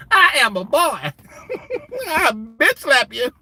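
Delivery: high squeaky comic threat. Retold or imitated. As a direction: puff up in a tiny high voice. Katt's character threatening Terry.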